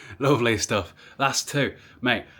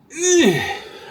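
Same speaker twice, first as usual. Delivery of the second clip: frustrated sigh; 5 dB louder than usual. Real, not acted. Frustrated stuck bulb.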